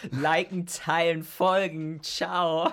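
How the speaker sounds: high, loud, hammed-up influencer sign-off; mock influencer. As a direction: chirp it like a gushing influencer. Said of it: "ich kling schon wie ein richtiger Influencer".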